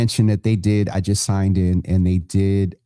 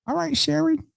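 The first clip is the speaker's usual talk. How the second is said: teasing; 12 semitones higher than usual. Real, not acted. Teasing host calls out Sharon.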